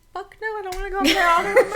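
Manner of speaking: high, loud, rushed protest; laughing mock refusal. Storyteller retelling her friend's refusal, high and laughing. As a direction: imitate a horrified refusal while cracking up.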